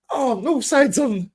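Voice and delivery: high, drawn-out put-on female voice; slow high gush, played for laughs; mock delighted girlfriend. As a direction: imitate her cooing with delight. Imitates delighted girlfriend seeing the show.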